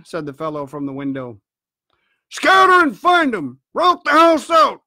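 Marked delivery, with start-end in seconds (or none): 2.3-4.8 loud, very high shouted orders; shouted reiteration. A narrator's reading. Blind man repeats his search order, shouting hard. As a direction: bellow the order with full force. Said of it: "reiterated Pew".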